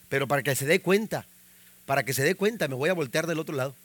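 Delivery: low, soft inner voice; muttered sulk. Retold or imitated. Husband acting out turning away from wife in bed, low muttering. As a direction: act out a private sulk under the breath.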